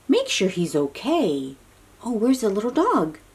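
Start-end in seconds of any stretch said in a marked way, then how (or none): none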